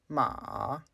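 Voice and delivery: MA dipping, then rising; dropping-rising tone. Shown as a demonstration. Teacher shows learners the third tone, "ma" falling then rising. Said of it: "a dropping and rising tone".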